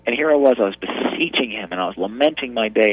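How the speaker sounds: ordinary talk, nothing marked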